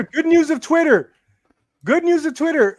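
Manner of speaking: high, punched-up segment call; segment announcer. Pretend. Host returning to the Twitter segment, announcer voice. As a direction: announce the title with showy volume.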